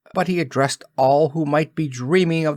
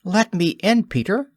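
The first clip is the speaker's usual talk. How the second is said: high, ringing fairy voice, much higher; lovely bell-like voice; 9 semitones higher than usual. A narrator's reading. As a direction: call sweetly like a tiny silver bell. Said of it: "spoke in a lovely bell-like voice".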